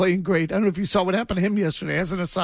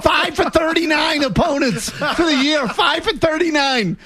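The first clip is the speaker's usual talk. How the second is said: incredulous excitement; 7 semitones higher than usual. Real, not acted. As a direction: crow the stat in amazement, voice pitched up. Host laughing over incredible statistic.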